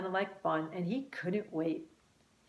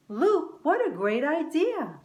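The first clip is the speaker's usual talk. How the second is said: delighted; 9 semitones higher, 5 dB louder than usual. A narrator's reading. Brother surprised, delighted.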